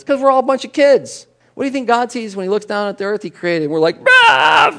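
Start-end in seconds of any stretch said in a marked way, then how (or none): 4.0-4.7 sudden high squawk of panic; childish outburst. Pretend. People squawking at God in a tantrum. Congregation acting like freaked-out kids.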